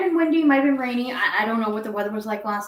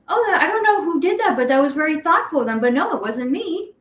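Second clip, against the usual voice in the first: higher, sweet polite neighbor; 7 semitones higher than usual. Retold or imitated. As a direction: lift the voice for the friendly neighbor. Neighbor quoted in retold text.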